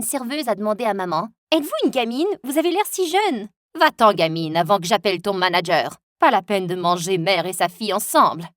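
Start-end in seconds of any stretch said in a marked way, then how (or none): 1.5-3.5 higher, chirpy waitress flattering. Acted. Waitress flatters mom as a kid. 3.8-8.5 lower, haughty brush-off; mother's put-down. Acted. Mother snaps at the waitress.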